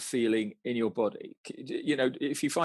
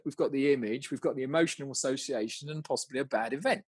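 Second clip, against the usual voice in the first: usual speaking voice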